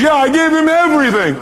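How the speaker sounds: high, mock-aggrieved wail; upset angry caller. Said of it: "because she's been angry".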